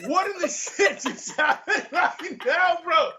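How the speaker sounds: high, laughing, incredulous; retelling the train toilet, high laughing disbelief. Retold or imitated.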